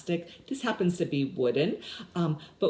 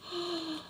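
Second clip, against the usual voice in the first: mock surprised gasp; 11 semitones higher, 8 dB quieter than usual. Teacher reveals a color card.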